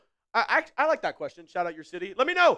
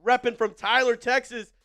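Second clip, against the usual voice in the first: louder, higher hype call to chat; hype streamer; 6 semitones higher, 7 dB louder than usual. Real, not acted. Streamer hyping almost six hundred viewers to shout out cities, excited. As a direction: pump up the viewers.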